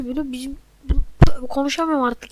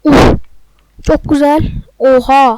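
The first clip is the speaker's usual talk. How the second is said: excited shout; 14 dB louder, slower than usual. Real, not acted. Excited reaction to a goal.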